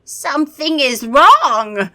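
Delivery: vampire repeats it in alarm. Acted. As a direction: drag out the worry. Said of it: "the vampire repeated".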